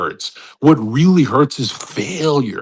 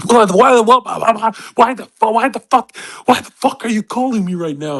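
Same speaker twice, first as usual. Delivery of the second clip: angry outburst; 10 semitones higher than usual. Retold or imitated. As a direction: snap at the caller, half-mocking.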